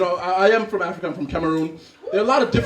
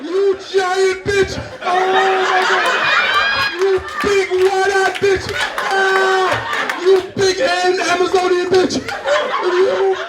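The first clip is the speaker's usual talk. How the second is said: high, wailing falsetto, hammed-up panic; crying baby; 11 semitones higher, much slower than usual. Baby afraid of heights screams at woman, high crying wail. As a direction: wail indignantly like a terrified baby. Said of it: "he starts immediately crying".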